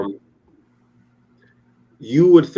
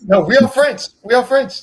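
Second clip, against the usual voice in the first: much higher, louder, hammed-up indignant Quebecer; mock Quebecois protest; 14 semitones higher, 8 dB louder than usual. Mocking a Quebecer insisting to the friends they are French. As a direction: play an offended Quebec local, way up top. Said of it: "If someone from Quebec was here, they would fight you over that".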